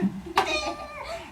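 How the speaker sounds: high, breathy villain giggle; creepy giggle. Acted. Cronus giggles at his father, high and sinister.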